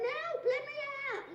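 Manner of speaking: retold shout. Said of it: "so I shouted".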